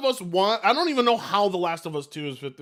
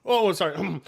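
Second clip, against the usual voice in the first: ordinary delivery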